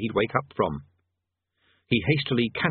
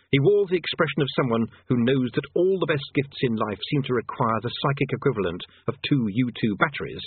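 usual speaking voice

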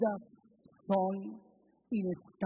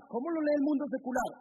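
rhetorical question; 9 semitones higher, faster than usual. Real, not acted.

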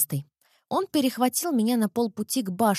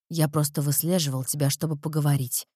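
low, calm vampire voice; grim casual admission; dark lord; 6 semitones lower than usual. A narrator's reading. Erik admits stalking.

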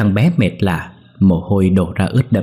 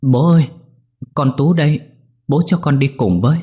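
pleading; 7 semitones higher than usual. A narrator's reading. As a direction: beg him through tears, high-pitched. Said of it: "van nài". (giọng van nài, cao và to)

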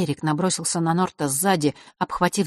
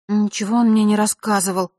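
frowning assurance; 5 semitones higher, 5 dB louder than usual. A narrator's reading. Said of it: "хмурясь заверила Василиса".

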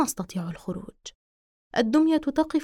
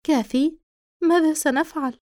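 put-on higher frightened young girl; 5 semitones higher, 4 dB louder than usual. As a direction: ask with a scared girl's voice. Frightened daughter asks father.

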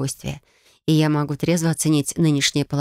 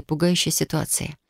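ordinary delivery

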